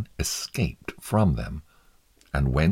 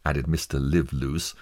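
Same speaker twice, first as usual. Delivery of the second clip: ordinary delivery, nothing marked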